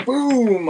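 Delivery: loud, high boom; imitated gunshot. Hunter retelling his own shot, loud mock boom. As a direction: punch it out like a rifle shot.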